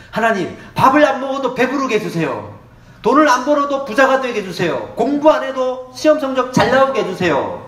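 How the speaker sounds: hammed-up wishful praying; mock lazy prayer. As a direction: beg God for the easy way.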